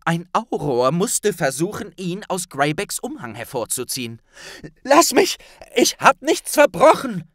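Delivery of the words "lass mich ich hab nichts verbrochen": high, loud desperate shout; panicked boy crying out; frantic cry. A narrator's reading. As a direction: yell it as if being dragged away. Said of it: "rief Harry".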